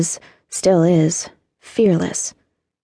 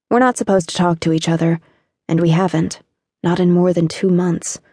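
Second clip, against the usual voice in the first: plain reading voice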